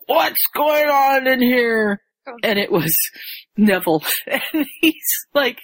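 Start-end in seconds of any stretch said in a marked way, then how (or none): 0.1-2.0 raised pitch, drawn-out demand; sudden stern voice. Retold or imitated. Neville interrupts the scuffle, high drawn-out demand. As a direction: call out slowly, catching them at it. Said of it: "they hear this voice".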